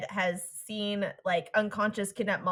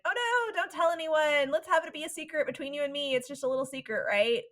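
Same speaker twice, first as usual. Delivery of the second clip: high-pitched imitation of a girl begging for secrecy; pleading heroine; 6 semitones higher than usual. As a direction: play-act a high, urgent little plea. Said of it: "she just kept going like".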